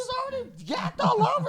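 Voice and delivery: high, fast, squeaky; excited. Real, not acted. Defending the burrito plan, high and fast. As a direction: rattle it off, thrilled and high.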